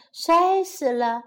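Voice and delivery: ordinary delivery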